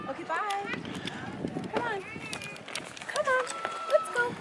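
high singsong coaxing. Real, not acted. Mother coaxing toddler to follow.

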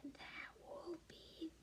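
hushed whisper so the giant sleeps; whisper, sneaking past a giant; breathless secret whisper. Acted. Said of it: "Be very quiet".

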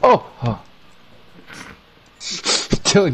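startled yelp melting into laughter; scream into giggles. Real, not acted. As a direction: jumpscare shriek then laugh. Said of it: "you scared the fuck out of me".

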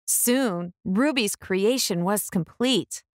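plain reading voice

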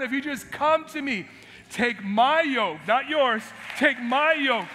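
loud, high, fervent delivery; preaching. Acted. Pastor voicing Jesus to the crowd, loud and fervent. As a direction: plead with rising fervour.

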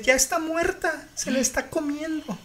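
high, sobbing panicked report; crying panic. Retold or imitated. Caller describing the attack to the operator, crying. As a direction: imitate a hysterical caller crying. Retold frantic 911 call.

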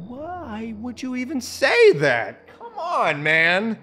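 high, loud, slow disbelief with a laugh; incredulous laughing protest. Real, not acted. Player mocked by the game, incredulous laughing protest.

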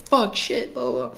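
careless cursing. Retold or imitated. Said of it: "I would just, like, say".

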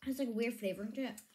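talking with candy in mouth; speaking between chews; chewing while talking. Real, not acted. Seen 1: Middle girl chews candy, hand at mouth; speaker somewhat unsure.

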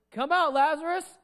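mock summons to the tomb; calling out. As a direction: call out with rising pitch. Re-enacting the call to Lazarus.

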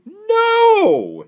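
very high, loud shouted refusal; angry shout. A narrator's reading. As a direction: shriek the refusal as an outraged aunt. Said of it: "shouted Aunt Agra".

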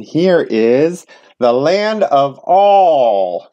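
slow, wondering storyteller reveal; grand reveal. A narrator's reading. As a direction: unveil the new land slowly. Reveals the land of all.

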